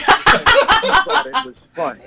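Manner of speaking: high laughing protest. Real, not acted. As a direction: laugh through the words. Laughing defensive retort.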